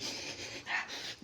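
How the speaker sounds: drawn-out noisy sniffs; imitated dog sniffing. Dog sniffs the man lying still, imitated sniffing. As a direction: sniff someone over like a hound. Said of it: "He just sniffs me".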